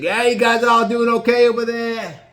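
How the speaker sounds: high, loud, jolly call across the boat; Gino calling out. Retold or imitated. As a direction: hail everyone from the back of the boat, teasing. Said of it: "he looked back and said".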